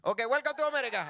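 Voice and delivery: high, loud mock welcome; welcoming border guard. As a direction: play a guard waving everyone in. Guard waves cousin's group through.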